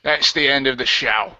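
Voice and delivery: ordinary talk, nothing marked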